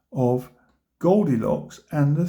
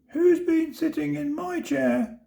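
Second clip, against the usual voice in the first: much higher squeaky little bear; teeny tiny squeak; 12 semitones higher than usual. A narrator's reading. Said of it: "said the baby bear in his teeny tiny voice".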